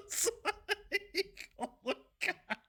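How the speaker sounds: high helpless laugh through the words; real laughter, high. Real, not acted. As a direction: crack up while saying it. Laughing at his own story.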